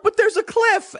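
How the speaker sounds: sudden high-pitched panic; alarmed warning. Retold or imitated. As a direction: blurt it out in disbelief. Frantic warning to passengers.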